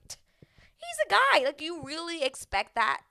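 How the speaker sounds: can't believe she'd expect that; incredulous retort. Retold or imitated. Own retold reaction.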